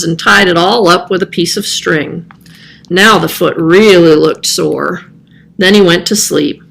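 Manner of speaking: ordinary delivery, nothing marked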